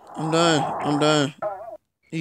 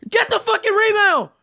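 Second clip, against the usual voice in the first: high-pitched frantic command; urgent yell; 11 semitones higher than usual. Real, not acted. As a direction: yell at your player in exasperation. Ordering player during game.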